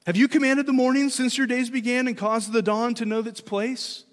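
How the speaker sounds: sarcastic. Acted. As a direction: grill them with lofty sarcasm. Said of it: "maybe with a little bit of sarcasm".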